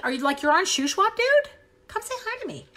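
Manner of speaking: delighted surprise at a nearby viewer; excited squeal. Real, not acted. Crafter to viewer who is close by, excited squeal.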